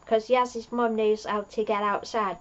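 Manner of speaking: ordinary talk, nothing marked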